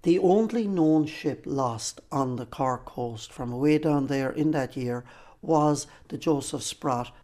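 ordinary delivery

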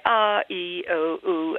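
a, I, o, u with slack lips, vowels drifting neutral; lazy English vowels. Shown as a demonstration. Coach showing lazy English vowels. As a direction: say a, I, o, u lazily. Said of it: "neutral, lazy look".

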